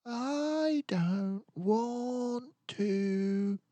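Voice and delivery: high, very slow whine; whiny little Boris. A narrator's reading. Cub refusing bed to his parents, high whine. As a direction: stretch it out, refusing. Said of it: "Boris said, just like every morning".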